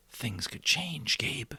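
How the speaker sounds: slow, hushed and breathy near-whisper; soft whisper. A narrator's reading. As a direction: murmur it slowly so the baby sleeps on. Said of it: "Jonas went on".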